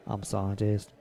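lightly done character voice, low and subdued; a half-hearted low impression; Eugene. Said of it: "this awesome Eugene impression".

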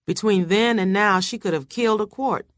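plain reading voice

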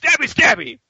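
high, sing-song and playful; mock gleeful killer. Rick mockingly stabbing Negan, high sing-song voice. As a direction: chirp it like a cheerful little stabber.

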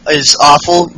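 usual speaking voice

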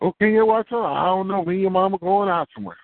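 put-on breezy parent brushing the kid off; parent on the phone.

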